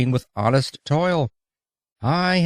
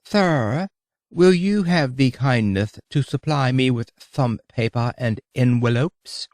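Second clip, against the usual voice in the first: affected English lisp; 2 semitones higher than usual. Retold or imitated. Dandy asking the hotel clerk for paper, lisping English accent. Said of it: "the lisp".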